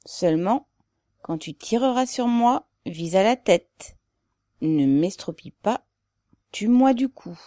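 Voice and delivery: usual speaking voice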